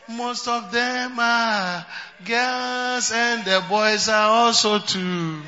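ordinary talk

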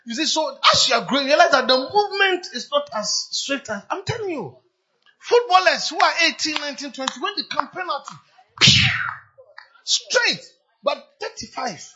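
high, loud preaching at full pitch; full-force preaching. Real, not acted. Preacher urges the young congregation, preaching loudly. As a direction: hammer it home from the pulpit.

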